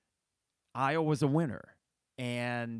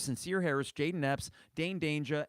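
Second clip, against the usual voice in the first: ordinary delivery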